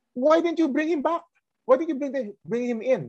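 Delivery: high, demanding question; voicing the Pharisees. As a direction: scold the guards for coming back empty-handed.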